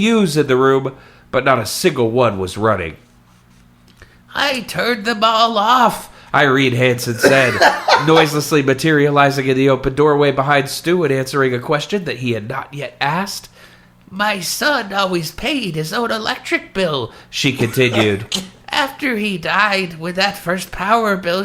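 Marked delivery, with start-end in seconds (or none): 4.3-6.1 put-on slow, high elderly woman's voice; old lady voice. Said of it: "Did you like my old lady voice". 14.1-17.1 put-on slow, high elderly woman's voice; old lady voice. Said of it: "Did you like my old lady voice".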